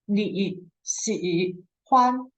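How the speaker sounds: each syllable said apart, slowly; 你喜欢 as three separate slow syllables; choppy separate syllables. Shown as a demonstration. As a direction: break it into separate characters. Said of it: "it will sound like".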